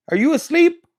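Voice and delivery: high, loud pet-directed question; calling the dog. Real, not acted. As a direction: pitch it up to rouse a sleepy dog.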